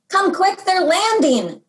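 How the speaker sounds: a grandmother calling everyone in, urgent; hollering. A narrator's reading. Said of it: "Gran hollered".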